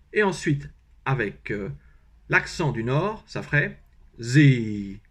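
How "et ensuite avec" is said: ordinary talk, nothing marked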